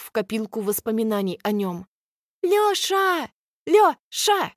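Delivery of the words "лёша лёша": high shout through cupped hands; yelling. Acted. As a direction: yell his name across the arena. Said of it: "вопит Алька в сомкнутые в рупор руки".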